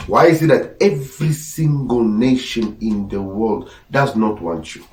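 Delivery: ordinary talk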